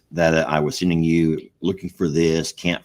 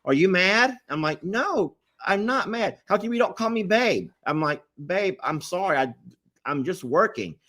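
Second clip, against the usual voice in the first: mimicking the nagging exchange; 10 semitones higher than usual. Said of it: "She's like".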